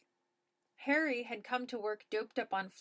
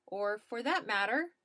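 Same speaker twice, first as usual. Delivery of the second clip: plain reading voice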